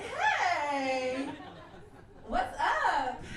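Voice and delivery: loud, drawn-out, overly casual greeting; trying hard to sound casual; forced casual. Retold or imitated. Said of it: "I'm trying to keep it casual".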